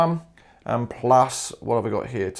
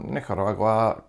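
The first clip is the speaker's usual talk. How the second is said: Nicaragua said with a light Spanish accent, rolled r and open "a" sounds; a hint of Spanish, ni-ka-RA-gwa; vaguely Spanish Nicaragua; 5 semitones lower, 6 dB quieter than usual. Shown as a demonstration. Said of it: "I had to sound even vaguely Spanish".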